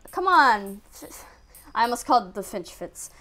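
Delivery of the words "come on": usual speaking voice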